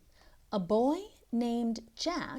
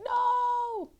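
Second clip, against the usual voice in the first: shocked cry; 4 dB louder than usual. Acted. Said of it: "He's like".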